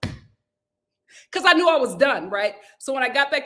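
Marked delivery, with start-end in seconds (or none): 0.0-0.1 mock shocked supervisor. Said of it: "supervisor was looking at me like".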